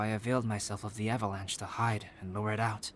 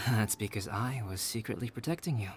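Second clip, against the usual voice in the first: usual speaking voice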